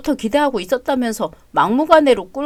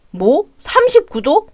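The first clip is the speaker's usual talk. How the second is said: shocked at the number; 3 semitones higher, slower than usual. Acted. Son shocked at fever.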